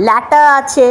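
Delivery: ordinary talk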